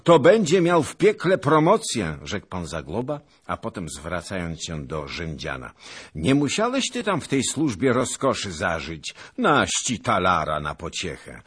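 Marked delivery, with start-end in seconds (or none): 0.0-2.2 loud, high-pitched gibe; boisterous mocking cry; jeering exclamation. A narrator's reading. Old noble's jeering interjection. 6.1-11.4 put-on loud, boisterous old noble; raised, jovial voice; hearty teasing. A narrator's reading. Hearty noble teases and rewards servant.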